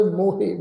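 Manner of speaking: ਮੋਹੇ said "mo-hey", final vowel sounded; ਮੋਹੇ in two syllables. Shown as a demonstration. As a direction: pronounce ਮੋਹੇ with a clear "he" ending. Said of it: "ਇਹ ਸ਼ਬਦ ਦਾ ਉਚਾਰਨ ਕਰਾਂਗੇ".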